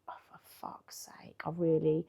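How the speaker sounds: exasperated whisper under her breath; whispered aside. Retold or imitated. Reacting to being asked number.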